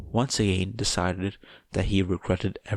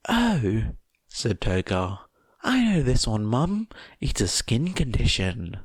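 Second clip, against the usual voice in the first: eager Toga voice; 4 semitones higher than usual. Acted. Toga eagerly answering her would-be mum. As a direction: chirp it out like a keen schoolgirl.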